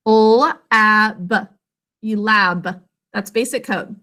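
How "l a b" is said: L-A-B as three separate phonic sounds. Shown as a demonstration. Teacher answers with second-syllable sounds, L-A-B segmented. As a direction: break L-A-B into "l", short "a", "b". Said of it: "What sounds do you hear".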